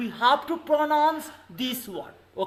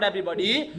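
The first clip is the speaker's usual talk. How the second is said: ordinary delivery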